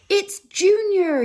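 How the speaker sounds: high, loud, slow proud cry; joyful shout. Acted. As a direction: announce the baby with delight. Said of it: "he shouted".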